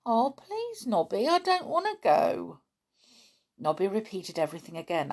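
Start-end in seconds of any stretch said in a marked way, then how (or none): 0.1-2.6 pleading chimp. Acted. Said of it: "as if he was saying".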